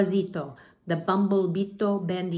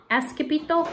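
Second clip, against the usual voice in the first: ordinary delivery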